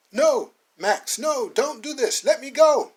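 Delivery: high, loud begging; desperate plea. Acted. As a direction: plead for his life. Max pleads at the noose.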